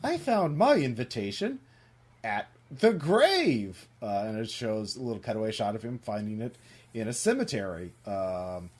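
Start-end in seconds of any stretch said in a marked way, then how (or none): 0.0-3.9 higher-pitched cartoon critter, drawn-out ending; lilting storybook animal voice; Mister Mouse. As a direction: stretch the last words slowly, pitched high. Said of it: "Mister Mouse says".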